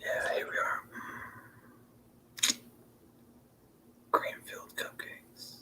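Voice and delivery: soft breathy whisper reading comments; hushed, unvoiced whisper. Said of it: "is what Wine Boy whispers".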